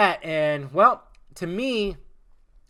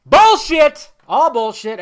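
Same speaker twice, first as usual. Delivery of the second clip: high, shouted dismissal; loud outburst; 10 semitones higher, 15 dB louder, slower than usual. Real, not acted. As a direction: shout it out as a debunking verdict. Calls out the false claim.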